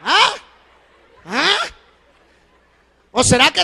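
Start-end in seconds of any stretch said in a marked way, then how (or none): 0.0-1.6 prodding high question. Real, not acted. Prodding the congregation.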